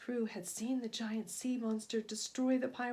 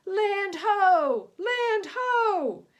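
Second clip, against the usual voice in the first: much higher and louder call; shouting; 9 semitones higher, 11 dB louder, much slower than usual. A narrator's reading. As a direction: call it out from the crow's nest. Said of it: "the lookout on the ship shouted".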